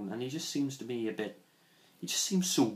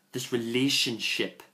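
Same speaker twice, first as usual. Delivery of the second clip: normal talking voice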